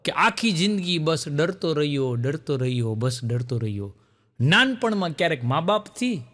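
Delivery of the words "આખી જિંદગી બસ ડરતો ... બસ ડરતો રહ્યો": poem recital. Retold or imitated. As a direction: recite the refrain dying away. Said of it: "ડર ઉપર કવિતા સંભળાવતા".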